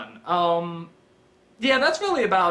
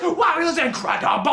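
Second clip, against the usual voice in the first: put-on a higher, amazed kid voice; excited kid; 8 semitones higher than usual. Kid amazed after the robot fight, higher. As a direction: gush like a wide-eyed kid. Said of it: "and he's just".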